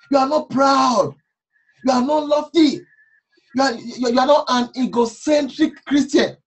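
rising preacher's emphasis on not; preaching. Acted.

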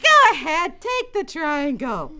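high, slow, drawn-out urging; lightly acted motherly insistence; coaxing mom. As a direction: coax the reluctant child slowly. Mother urges sister.

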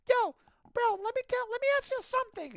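very high falsetto impersonation; Lewis. Retold or imitated. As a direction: imitate a coworker with a really high-pitched voice. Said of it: "a really high-pitched voice".

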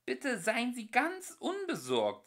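high, slow soothing stewardess voice; friendly stewardess. Acted. Ship's voice calming the travellers like a stewardess. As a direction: reassure like a cabin attendant. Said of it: "sagte freundlich die Stimme".

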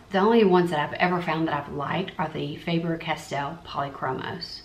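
ordinary talk

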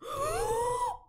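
sharp gasp. Real, not acted. Startled by something in game.

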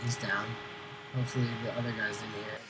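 quiet, fast hush. Real, not acted. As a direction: keep it down, enemies nearby. Stealth kill, hushed talk.

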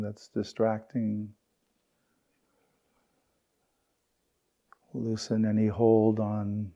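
unhurried meditation guide, long pauses between phrases; guided meditation. Real, not acted. Teacher guiding the group through belly breaths and settling, guided meditation.

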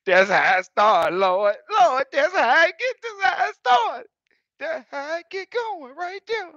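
high, loud wailing Lord; mock lament. Trucker mock-wails at the scale, very high. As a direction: wail to the Lord in comic despair. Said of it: "I said".

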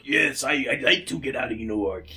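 put-on vague Russian-by-way-of-New-York accent; muddled Russian accent. Podcaster voicing Russian henchman, muddled Russian accent. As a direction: attempt Russian and land near New York. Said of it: "general purpose, wrong turn in New York accent".

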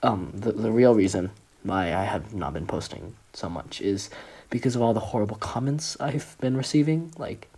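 ordinary delivery

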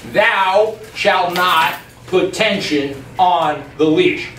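slow, measured pronouncement; deliberate, spaced-out decree; solemn commandment. Acted. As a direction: lay down the law word by word. Mock commandment for lesson one.

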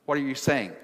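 ordinary delivery

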